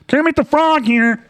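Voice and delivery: very high, drawn-out puppet frog; silly high frog-puppet voice; Kermit the Frog. Acted. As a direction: stretch it into a squeaky frog announcement. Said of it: "doing that stupid Kermit voice".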